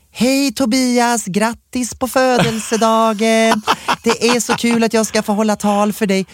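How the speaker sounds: slow, high singsong birthday greeting; drawn-out, high-pitched mock congratulations; gushing video greeting. Guest filming a birthday speech for Tobias, slow and gushing.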